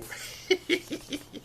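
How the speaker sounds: high giggle; light, high-pitched chuckle in a quick run. Real, not acted. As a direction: giggle with delight. Amused by hometown viewer's comment.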